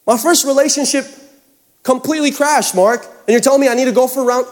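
high-pitched, mock-incredulous objection; protesting congregant. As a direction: imitate a listener pushing back.

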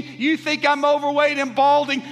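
high, loud, rhythmic sermon climax; full-force preaching. Acted. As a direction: proclaim each line to rouse the church.

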